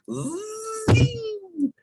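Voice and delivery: a very high, piercing "zing" like a spinning top; zing sound effect. Retold or imitated. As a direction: shriek the spin noise way up high.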